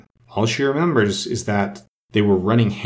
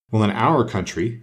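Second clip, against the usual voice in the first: ordinary delivery, nothing marked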